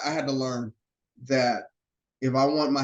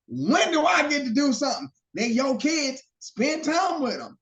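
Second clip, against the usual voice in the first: high-pitched mock rant; imitating angry wife; 8 semitones higher than usual. Husband retelling his wife chewing him out, mock rant. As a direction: mimic her frustrated tirade, voice climbing. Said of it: "she's like".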